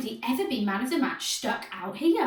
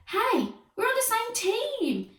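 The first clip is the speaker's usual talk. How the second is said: high, slow yelp; high, drawn-out hurt yelp. A narrator's reading. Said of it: "yelped Jim".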